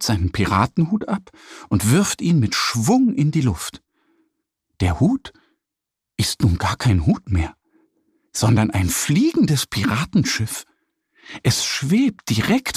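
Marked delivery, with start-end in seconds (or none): none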